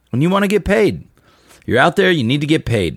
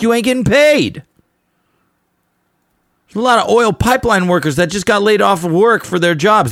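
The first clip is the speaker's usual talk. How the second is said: aggressive rant; 8 semitones higher than usual. Real, not acted. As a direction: call the musicians out, fired up. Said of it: "I'm gonna get a little aggressive".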